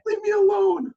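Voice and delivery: high, loud mock complaint; mocking a parent. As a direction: squeal it out as a nagging parent. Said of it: "It was like".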